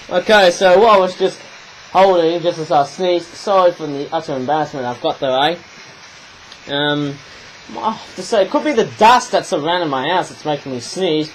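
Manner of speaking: ordinary talk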